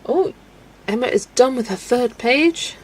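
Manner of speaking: usual speaking voice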